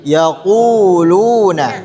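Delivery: slow "yaquluna" with a deep throat q; deep qaf. Shown as a demonstration. Teacher contrasts qaf against kaf, slowly. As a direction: draw out "yaquluna", q from the back of the throat. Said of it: "Bukan yakuluna".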